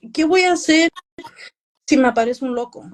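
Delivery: nervous fretting. Retold or imitated. Author voicing pre-event jitters to the host, nervous fretting. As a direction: fret aloud in Spanish, imitating your own nerves. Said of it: "I'm so nervous".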